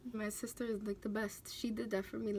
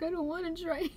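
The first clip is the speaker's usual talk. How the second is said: voice breaking; 7 semitones higher, 4 dB louder than usual. Real, not acted. Retelling miscarriage, voice breaks crying.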